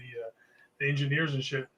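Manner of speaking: ordinary talk, nothing marked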